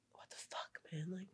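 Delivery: unvoiced whisper of anger; whispered thought. Hiker voicing her silent anger at her friend, whispered. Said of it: "in my head, I'm like".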